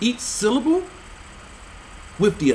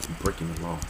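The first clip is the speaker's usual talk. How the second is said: muttered aside; 10 dB quieter, much faster than usual. Real, not acted. Aside after lisp complaint.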